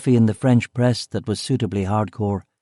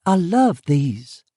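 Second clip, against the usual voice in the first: high, drawn-out female character voice; delighted exclamation; 8 semitones higher, much slower than usual. Acted. Woman seeing a box of treats, exclaiming with delight. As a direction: exclaim it high, lingering on the words. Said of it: "she exclaimed".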